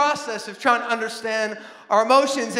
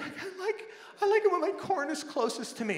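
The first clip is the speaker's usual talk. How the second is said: higher, much softer fussy little voice; picky child; 8 semitones higher, 8 dB quieter than usual. Retold or imitated. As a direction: whine it like a picky four-year-old. Picky child about plate.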